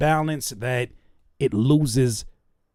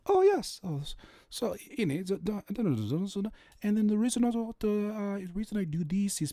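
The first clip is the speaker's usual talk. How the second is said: mock posh singer; 6 semitones higher, 7 dB quieter than usual. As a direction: play a dainty singer in an interview, a little hammed up. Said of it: "they'll be like".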